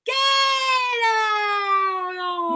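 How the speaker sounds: very long, loud, sustained vowels; stretched vowels. Shown as a demonstration. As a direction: hold each vowel out at full volume. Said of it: "just play with the vowels".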